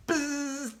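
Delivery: nasal-free flat buzz imitating a quiz buzzer. A narrator's reading. Reader imitates the quiz buzzer, steady buzz. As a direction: make the buzzer noise with your lips.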